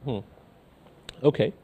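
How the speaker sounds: usual speaking voice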